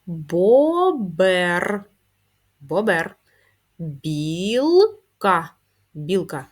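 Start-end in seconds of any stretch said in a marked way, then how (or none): 0.0-1.8 Бобер. drawn out, louder, syllable by syllable; teacher modelling slow reading. Shown as a demonstration. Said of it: "прочитаємо їх". 3.8-5.5 Білка. drawn out and louder, syllable by syllable; teacher modelling slow reading. Shown as a demonstration. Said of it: "прочитаємо їх".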